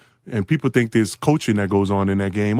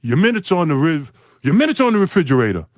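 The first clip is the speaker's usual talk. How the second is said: higher, louder curt coach voice; mocking a gruff coach, louder and higher; Larry Bird's curt reply; 6 semitones higher, 3 dB louder than usual. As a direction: bark it like a gruff coach brushing off a rookie. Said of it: "Larry Bird looks at me and goes".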